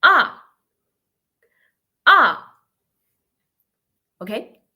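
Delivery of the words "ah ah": AH starting high and dropping fast; short loud AH, like an angry no; fourth tone, falling sharply. Shown as a demonstration. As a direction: hit AH high, then plunge. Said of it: "And the last one".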